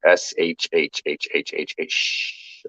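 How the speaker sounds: long soft shh sound; shushing. Shown as a demonstration. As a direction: push out a steady shh.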